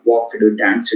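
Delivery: "walked, danced" as walk-ed, dance-ed; stressed E-D ending. Shown as a demonstration. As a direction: add a separate ED syllable to "walked, danced". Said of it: "we tend to emphasize the E D part".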